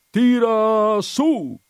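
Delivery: high, loud, drawn-out heave-ho call; sailors' shout. A narrator's reading. Sailors hauling crates, shouting in chorus. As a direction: haul the crates, shout it out together. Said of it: "gridavano in coro".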